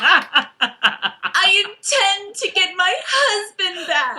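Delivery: slow, high-pitched melodramatic declaration; drawn-out mock heroine, laughing; Claire. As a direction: overact a badly written dramatic line. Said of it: "bad Claire line number one".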